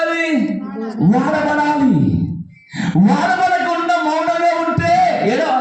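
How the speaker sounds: loud, high, driving sermon cadence; fiery preaching. Acted. Preacher rousing the congregation about being used, fiery preaching. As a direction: preach it out to the back rows.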